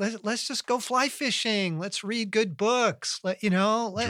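high, loud playful pleading; mock pleading. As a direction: beg for fun like a restless kid.

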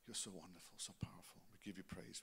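softly whispered adoration; whispered praise. Real, not acted. Pastor whispering adoration to God in prayer. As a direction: breathe the praise out. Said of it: "whispered prayer".

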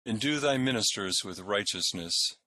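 solemn prayer voice; liturgical prayer. Acted. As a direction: lead the prayers in church. Intones versicles and collects.

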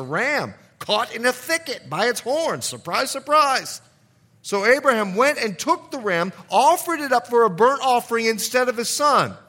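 preacher raising his voice to the church; preaching at full force. Acted. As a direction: build the fire of the sermon.

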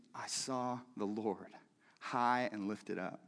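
hushed, low and slow, full of wonder; quiet reverent awe. Acted. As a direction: breathe it out like a holy secret. Awed quote of Isaiah's vision.

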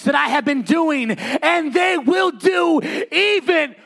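loud, high, shouted sermon climax; full-force preaching. Acted. Pastor shouting Jesus' promise of the Spirit to the church. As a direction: thunder it over the congregation.

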